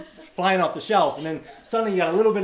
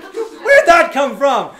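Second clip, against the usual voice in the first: loud, high, drawn-out comic yell; hammed-up shout, stretched out; mock outburst; 10 semitones higher, 15 dB louder, much slower than usual. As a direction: slowly bellow it in exaggerated disbelief. Mock cry, at car pulling away.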